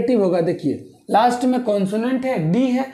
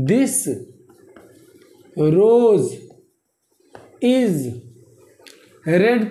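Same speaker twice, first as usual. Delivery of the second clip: long pauses between words; measured classroom dictation; much slower than usual. Shown as a demonstration. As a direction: dictate one word at a time. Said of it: "teacher dictating word by word".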